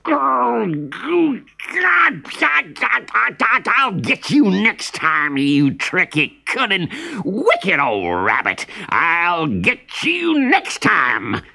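high, spluttering villain's fury; splutter, then slow into a drawn-out threat; angry fox. A narrator's reading. Outwitted fox threatening the rabbit, high angry spluttering. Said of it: "he was so angry".

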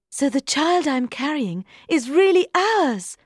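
high, bright joyful cry; delighted. A narrator's reading. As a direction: burst out with delight. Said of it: "cried with delight".

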